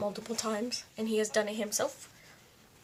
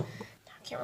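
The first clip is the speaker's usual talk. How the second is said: whisper; 12 dB quieter than usual. Real, not acted. Whispered thinking aloud.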